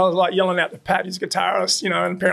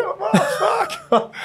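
stunned mates; 13 semitones higher than usual. Retold or imitated.

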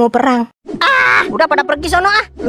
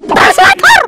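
high, loud outburst; shouting the correction; 6 dB louder than usual. Acted. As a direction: blurt it out loud.

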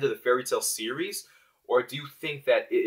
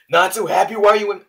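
surprised shout; 7 semitones higher, 9 dB louder than usual. Acted. Lucy finding her room cleaned.

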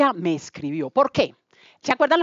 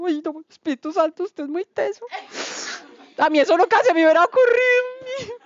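high, tearful voice breaking; overwhelmed, close to tears; 11 semitones higher than usual. Real, not acted. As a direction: voice cracking with emotion. Moved by God's idea, tearful.

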